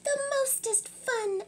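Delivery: high little sister. Acted. Littlest sister agrees about watching Darwin. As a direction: squeak it like the littlest.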